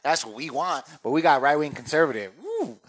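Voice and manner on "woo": high, short "woo" hoot; mock country whoop. Comic mocking right-wing country folk, whooping. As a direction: hoot like a rowdy good ol' boy.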